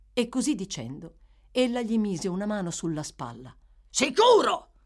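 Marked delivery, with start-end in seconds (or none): 3.9-4.7 loud, high bark of defiance; shouted. A narrator's reading. Sykes answers the girl's plea, shouting. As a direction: shout it straight back at her. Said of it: "gridò Sykes".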